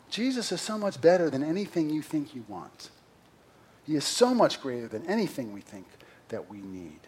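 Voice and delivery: ordinary delivery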